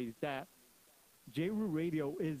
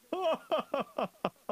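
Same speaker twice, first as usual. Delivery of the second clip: loud, high acted guffaw; mocking soldier's laugh; 9 semitones higher, 5 dB louder than usual. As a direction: laugh in the intruder's face.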